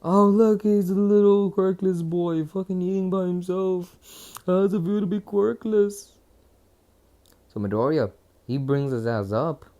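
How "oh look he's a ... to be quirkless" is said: high, louder sneering bully; Monoma's voice pitched up, louder and sneering at the loner; mocking bully voice. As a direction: taunt him like a smug rich kid, pitched up. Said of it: "that motherfucker comes up saying".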